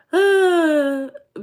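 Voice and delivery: high, loud, wailing sigh; loud drawn-out sigh. Real, not acted. Streamer to John before explaining she forgot, wailing sigh. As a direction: let out a long voiced sigh.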